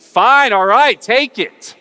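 loud, high, drawn-out exasperated outburst; exasperated giving in. Acted. Imagined God worn out by prayers, exasperated shout. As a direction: give in at the top of your voice, acted.

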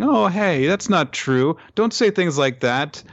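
lightly voiced sweet girl; friendly reassurance. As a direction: coax him like a kind friend. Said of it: "offering a hand of friendship and saying".

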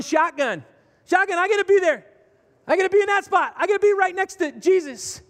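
high, loud mock shouting to claim a seat; hammed-up disciples yelling over each other; yelling shotgun. Disciples fighting for the best seat, loud mock yelling. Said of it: "they basically were yelling".

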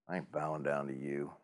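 low, quiet, stubborn refusal; defiant Mordecai. Retold or imitated. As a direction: mutter it like a man who won't bend. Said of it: "Mordecai's like".

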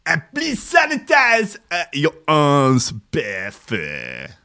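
high, loud put-on attendant voice slowing to a drawl; mock attendant. Playing the clinic attendant telling him to sanitize, hammed-up high voice. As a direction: ham up the jab-centre staff instruction. Mimicking staff at vaccine centre.

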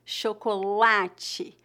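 "chocolate" slowly, weight on LA; stress on penultimate. Shown as a demonstration. Teacher showing learners the tonic syllable, stress on penultimate. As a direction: stretch "chocolate" as sho-ko-LAA-chee. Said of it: "a sílaba tônica dessa palavra é a penúltima".

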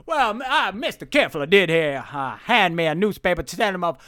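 declaiming Southern showman; Southern newsreader impression. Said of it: "and he says".

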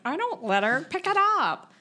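high, loud attempt at a German accent; botched German accent. Imitating a bitchy secretary, botched German accent. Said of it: "I can't do a German accent".

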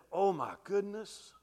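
slow, drawn-out dismay; the groom waking to the wrong bride, dismayed; a let-down oh my goodness. Retold or imitated. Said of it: "looks at you and said".

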